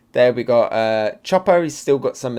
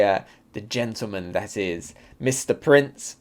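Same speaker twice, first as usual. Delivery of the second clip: ordinary delivery, nothing marked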